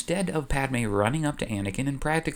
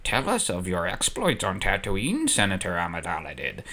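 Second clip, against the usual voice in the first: put-on lower, odd-timbred old Jedi master; Yoda voice; 5 semitones lower, 4 dB quieter than usual. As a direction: croak it out like a tiny ancient sage. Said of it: "and Yoda continues".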